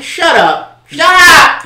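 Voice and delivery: high-pitched, full-volume yell; exasperated shouting. Acted. Podcaster mocking a wrestler's hurt feelings, exasperated shouting. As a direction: shout it down, rising to a shriek.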